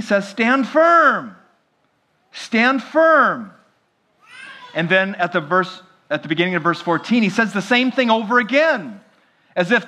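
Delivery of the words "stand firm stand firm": higher, louder, ringing call to stand firm; forceful rallying cry; proclaimed command. Acted. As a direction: preach the charge out. Preacher proclaims Paul's command.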